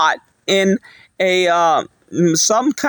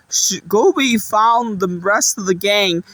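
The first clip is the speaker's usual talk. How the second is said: ordinary talk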